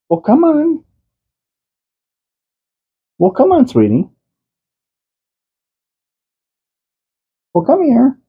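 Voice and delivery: high, sing-song calling to the cat; coaxing call. Real, not acted. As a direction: call the cat over sweetly. Dad calling Grandma cat over.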